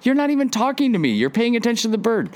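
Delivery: high, rushed mock-annoyed wife; imitating the wife. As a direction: squeak out the wife's annoyed complaint quickly. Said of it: "She's like".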